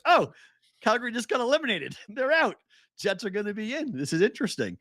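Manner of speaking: high, delighted retelling of the news; a burst of excited surprise, voice up high. Retold or imitated. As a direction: relive the thrill of the moment. Retold reaction to elimination news.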